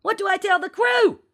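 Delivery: very high, loud wail; whining. A narrator's reading. Percival begs captain for orders, whining loudly. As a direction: wail it helplessly through the door. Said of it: "Percival whined".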